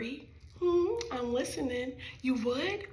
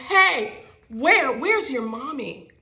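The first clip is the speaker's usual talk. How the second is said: put-on child's loud call; 3 semitones higher, 10 dB louder, slower than usual. Child calls out question.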